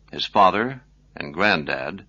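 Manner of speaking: ordinary delivery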